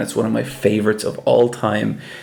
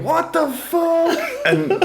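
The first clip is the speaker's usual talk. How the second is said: high, slow disbelieving laugh; high shocked laugh; much slower than usual. Retold or imitated.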